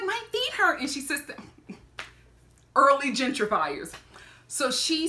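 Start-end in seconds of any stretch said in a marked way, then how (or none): 0.1-0.8 high-pitched imitation of a woman complaining; high protesting voice. Lady defending her seat, high mock protest.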